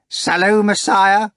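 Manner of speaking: high, loud cheerful greeting; excited cry. A narrator's reading. The couple greeting Voland, loud gleeful high cry. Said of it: "cried the restless couple".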